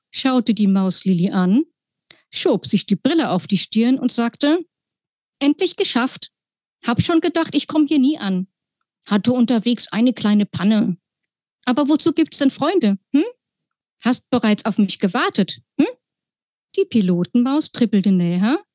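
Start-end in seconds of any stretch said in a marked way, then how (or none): 5.4-15.9 high, chirpy little mouse pilot. Acted. Pilot mouse greets the girl in a high squeaky voice. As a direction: play the cheeky pilot mouse, pitched up.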